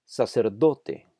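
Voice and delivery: Sacerdote slowly, stressing "do"; emphasized stressed syllable. Shown as a demonstration. Teacher demonstrating prosodic accent, slow Sacerdote stressed on "do". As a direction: draw out and lean on the "do" in Sacerdote. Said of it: "Note que hay un énfasis de pronunciación".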